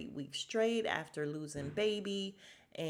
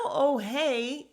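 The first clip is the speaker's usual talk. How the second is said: mock greeting; 7 semitones higher, 9 dB louder than usual.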